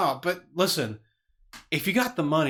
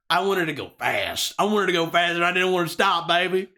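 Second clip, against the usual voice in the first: building manic rant, climbing higher; manic excitement; 6 semitones higher, 3 dB louder than usual. Real, not acted. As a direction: wind yourself up, thrilled by the speed. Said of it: "You're just scaring me".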